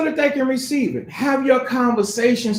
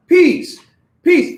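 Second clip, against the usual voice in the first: "Peace" called out higher and louder, hard; forceful peace; 6 semitones higher, 5 dB louder than usual. Shown as a demonstration. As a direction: push the word "Peace" out strong and firm. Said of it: "No, peace".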